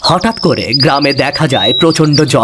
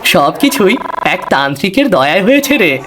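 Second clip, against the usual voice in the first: higher, laughing with happiness; delighted young man chuckling through words; overjoyed, laughing; joyful laughing; 5 semitones higher than usual. Acted. Bishu joyful about his leg.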